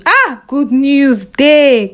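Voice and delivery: slow, loud drawn-out announcement; [name] teasing wife with news, loud and slow; excited news. Acted. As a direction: stretch it out with glee.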